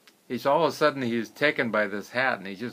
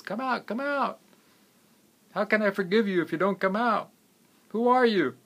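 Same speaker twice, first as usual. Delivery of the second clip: very high, insistent call; 10 semitones higher, 4 dB louder than usual. Acted. Wealthy man calling to a stranger in a cave, calling out. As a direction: call out to someone hidden.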